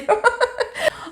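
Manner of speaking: high, breathy giggle; short sheepish giggle; embarrassed laugh. Real, not acted. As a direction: laugh at your own fumble. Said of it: "Je suis désolée".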